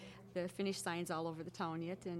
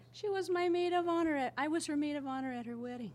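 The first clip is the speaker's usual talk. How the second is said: excited quoted reply; 7 semitones higher, 5 dB louder than usual. Retold or imitated.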